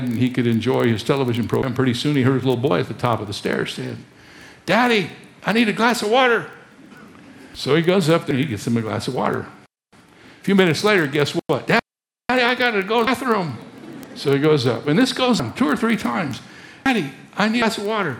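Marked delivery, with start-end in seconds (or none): 4.7-6.5 put-on high child's plea called down the stairs; little boy. Boy at top of stairs calls to his father, pleading child voice. 11.7-13.6 put-on high whiny child calling out; little boy. Boy calls down to his dad again, whiny child voice. 16.8-18.2 put-on quick, high, rattled-off child's plea; little boy. Boy keeps calling his father for water, rushed child voice. Boy calls a third time.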